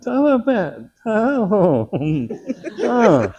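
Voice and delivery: high, loud wordless-style moaning, hammed up; speechless shock.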